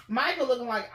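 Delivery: ordinary delivery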